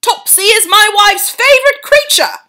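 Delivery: loud, high, flustered; father defending the cat; agitated father. A narrator's reading. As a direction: protest it urgently.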